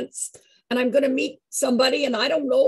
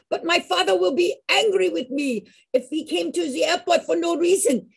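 put-on higher, lightly French-accented worry; a hint of French accent, pitched up; 7 semitones higher than usual. Madeleine fretting about her angry father, light French accent. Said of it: "she had the most lovely French accent".

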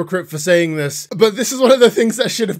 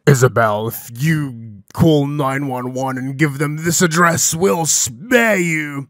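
menacing killer; 4 dB louder, slower than usual. Retold or imitated. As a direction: drag it out like a threatening killer. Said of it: "I'm not doing the accent well enough".

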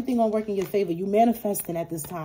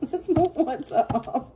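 laughing; 8 semitones higher, slower than usual. Real, not acted. Laughing while moving on.

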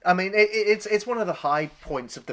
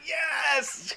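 triumphant cheer; 11 semitones higher, 3 dB quieter than usual. Retold or imitated. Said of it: "It's like".

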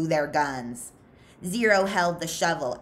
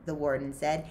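ordinary delivery

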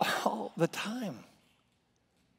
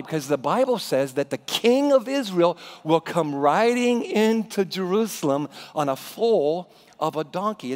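ordinary talk

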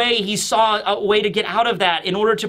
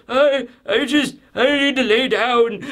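put-on overwhelmed old man; 3 semitones higher than usual. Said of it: "And he's like".

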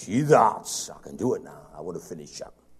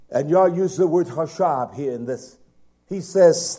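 ordinary delivery

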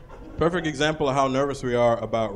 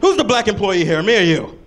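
defensive coworker; 8 semitones higher, 6 dB louder than usual. Retold or imitated.

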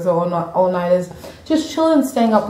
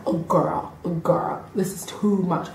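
low, soft retold murmur; hushed aside; 3 semitones lower, 8 dB quieter than usual. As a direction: murmur it privately to a friend in the cinema. Retells muttering to friend at cinema.

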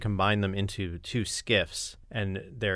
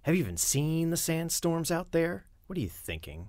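matter-of-fact; 7 semitones higher than usual. Shown as a demonstration. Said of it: "here's my go on it".

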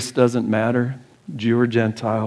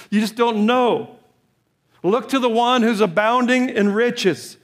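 high, impassioned pulpit cry held throughout; fervent preaching; 10 semitones higher than usual. Acted. Pastor urging the church to call on the Lord, fervent high preaching. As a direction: plead with the whole congregation at full force.

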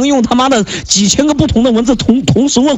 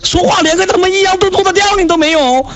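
mocking angry rant; 8 semitones higher than usual. Real, not acted. Mocking rant about English.

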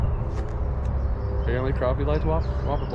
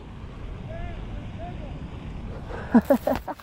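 breathless laugh; 10 semitones higher, 6 dB quieter than usual. Real, not acted. Laughing after big catch.

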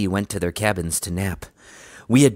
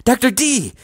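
high, loud, joyful call of his name; excited cry; 7 dB louder than usual. Acted. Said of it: "cried Sheena".

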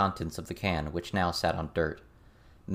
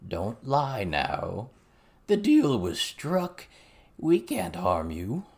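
slow, drawn-out frog-like goblin voice; slow froggy goblin with swooping pitch; croaking goblin; 4 semitones higher, much slower than usual. A narrator's reading. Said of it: "in his frog's croak of a voice".

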